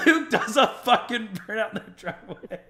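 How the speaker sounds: high, loud, laughing through it; can't stop laughing while telling it. Real, not acted. Telling the burnout story, laughing hard.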